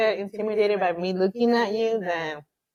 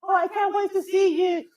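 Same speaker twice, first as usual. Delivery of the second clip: higher mock flirty voice; mock texter; 11 semitones higher, 3 dB louder than usual. Reading out a gushing text from a man, mock flirty. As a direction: parody a gushing text message. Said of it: "Eggplant, eggplant".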